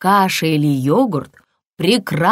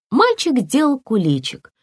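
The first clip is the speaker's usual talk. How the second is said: ordinary delivery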